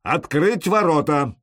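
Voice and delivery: slow loud command. A narrator's reading. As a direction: order it with authority. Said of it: "повелительный голос снизу распорядился".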